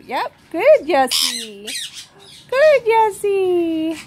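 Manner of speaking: slow, high, drawn-out praise for a pet bird. Real, not acted. Owner praising her parrot for ripping the tissue, slow high pet talk.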